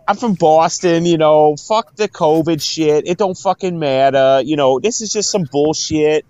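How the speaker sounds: hammed-up Boston dismissiveness; Boston accent. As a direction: rant in a broad Boston accent. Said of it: "she had that Boston".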